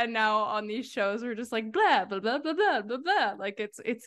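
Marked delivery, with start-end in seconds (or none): 1.7-3.3 rising carefree blah blah; mock effortless chatter; breezy blah blah. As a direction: toss it off like nothing. Mocking easy chatter.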